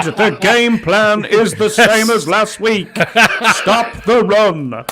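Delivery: ringing proclamation like a royal herald; town crier voice. Acted. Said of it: "It is sound crier voice".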